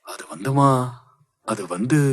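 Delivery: stalling, words left hanging; hesitant. Acted. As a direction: fumble for an excuse. Caught sneaking out by mother. (தயங்கித் தயங்கி)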